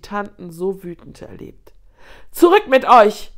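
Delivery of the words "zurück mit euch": very loud, furious command; raging. A narrator's reading. Lioness mother driving hyenas back, raging shout. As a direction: roar at them to back off. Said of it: "tobte Salama".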